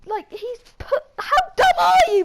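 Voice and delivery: high, loud laughing outburst at the stupidity; laughing disbelief. Real, not acted. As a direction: laugh out the question in sheer disbelief.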